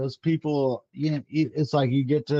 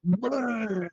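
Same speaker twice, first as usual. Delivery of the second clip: imitated puking noise, raised pitch; mock retching; 7 semitones higher, 3 dB quieter than usual. Retelling puking on the obstacle course, mock retching. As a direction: retch it out like you're throwing up mid-run. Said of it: "I just start".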